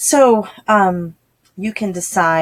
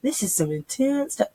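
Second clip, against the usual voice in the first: put-on higher, excited "oh girl" to a friend; gushing bestie voice; 7 semitones higher than usual. Tutor tells her bestie, higher, excited girlfriend voice. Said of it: "They're like".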